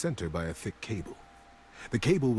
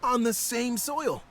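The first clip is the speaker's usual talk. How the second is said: joyful greeting; 10 semitones higher than usual. A narrator's reading. Stranger greeting long-lost rival.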